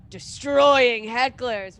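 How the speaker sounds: "destroying" stretched out slow and loud; drawn-out emphasis. Real, not acted. Comic mocking video titles, "destroying" loudly drawn out. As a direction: lean hard on DESTROYING, slowly.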